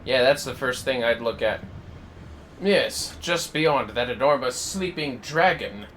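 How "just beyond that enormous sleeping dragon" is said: ordinary delivery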